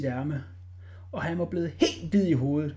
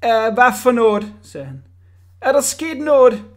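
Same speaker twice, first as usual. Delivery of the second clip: higher, louder baffled; startled director; 6 semitones higher, 10 dB louder than usual. A narrator's reading. Director confused by the lady, startled. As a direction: caught off guard.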